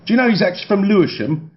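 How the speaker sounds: put-on eager young pap telling tales. A narrator's reading. Young pap gossiping about Jude Law, high eager voice. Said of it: "said a very young pap".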